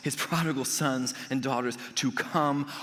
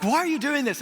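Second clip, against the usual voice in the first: high, louder startled question; excited question; 10 semitones higher, 4 dB louder than usual. Retold or imitated. To the Disney guide, high excited question. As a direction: blurt it out in happy disbelief.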